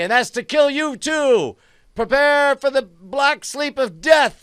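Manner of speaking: high, loud action-hero proclamation; hammed-up battle threat. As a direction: proclaim doom to your enemy, drawing out the end. Battle threat before a punch.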